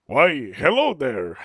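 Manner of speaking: hammed-up accent, big swoop on the greeting; overdone imitation of a faked Spanish-style accent; accent of someone doing an accent. Greeting as someone putting on an accent, exaggerated accent. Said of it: "do an accent of someone doing an accent".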